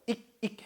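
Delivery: ich said as "ik", with a hard k; hard "ik" ending. Shown as a demonstration. Teacher mimics the non-standard ending, ich as "ik". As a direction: stop each ich short on a k. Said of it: "해버리는 경우가 많아요".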